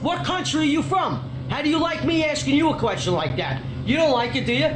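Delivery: high, heated demands; real confrontational outrage; angry interrogation. Real, not acted. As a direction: grill someone angrily, demanding answers. Berates clerk with repeated demands.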